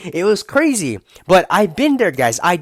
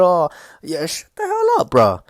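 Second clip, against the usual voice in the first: mock dismissal; 3 semitones higher than usual. Brushing off the cheeseburger excuse, squeaky and mocking. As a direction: sneer it off in a squeaky voice.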